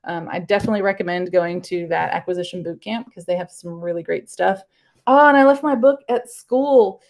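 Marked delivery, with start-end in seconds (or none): 5.1-7.0 sudden realization. Real, not acted. Teacher realizes book left behind.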